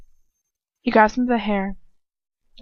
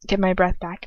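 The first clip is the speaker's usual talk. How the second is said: usual speaking voice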